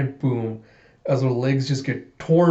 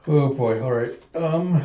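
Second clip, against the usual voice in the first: ordinary talk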